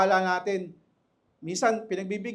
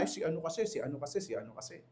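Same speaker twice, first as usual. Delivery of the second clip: excuses trailing off softer and lower each time; mock blame shifting; 6 semitones lower, 12 dB quieter, much faster than usual. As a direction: mumble the excuse, fading into nothing. Church member blaming others when scolded.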